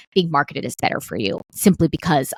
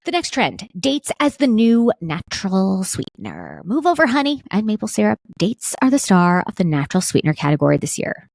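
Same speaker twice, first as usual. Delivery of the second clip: announcer voice; 3 semitones higher than usual. Acted. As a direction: present it like a product ad. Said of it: "Do I sound like an announcer?".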